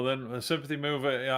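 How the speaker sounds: ordinary delivery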